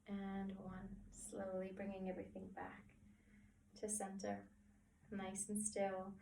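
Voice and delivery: soft countdown, settling into stillness; guided relaxation. Acted. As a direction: count them down gently, unhurried, with long pauses.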